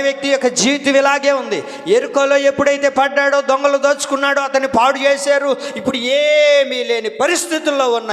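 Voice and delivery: high, loud fiery preaching; preaching at full force. Acted. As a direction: drive the sermon home from the pulpit. Preaching with raised voice.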